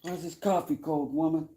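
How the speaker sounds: high, loud mock tirade; mock angry husband. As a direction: bark it like a cranky husband.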